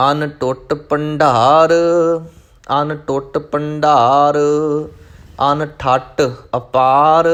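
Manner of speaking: slow, sustained devotional chant; scripture recitation. Acted. Teacher reciting scripture for learners, slow chanting. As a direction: recite measuredly, drawing out each line's last syllables.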